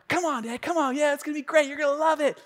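put-on high, eager kid begging dad; pleading child. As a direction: coax dad in a small high voice. Voicing his pleading daughter.

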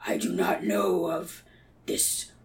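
slow, eerie whispered beast; half-laughing creepy monster whisper. Acted. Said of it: "and he goes".

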